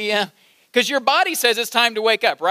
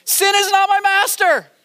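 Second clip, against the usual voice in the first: higher, ringing proclamation; emphatic proclamation; 10 semitones higher than usual. Shown as a demonstration. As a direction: proclaim it to the whole room. Congregation urged to repeat loudly.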